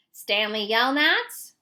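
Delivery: loud, slow call across the lake. A narrator's reading. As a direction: call his name out loud from a distance, drawn out. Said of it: "she called".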